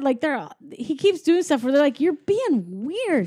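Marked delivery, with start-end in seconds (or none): 2.0-3.3 high, drawn-out disbelief; mock bewildered. Toys telling their friend off, drawn-out and baffled. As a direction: stretch it out in mock judgement. Toys judging odd behaviour.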